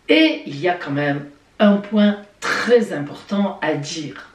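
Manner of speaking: ordinary talk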